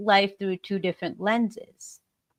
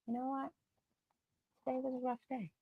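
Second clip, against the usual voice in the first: soft resigned; 3 semitones higher, 16 dB quieter, much faster than usual. Shown as a demonstration.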